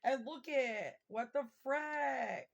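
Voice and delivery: loud, high, drawn-out amazement at the cards; stunned exclaim. Real, not acted. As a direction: blurt it out in disbelief.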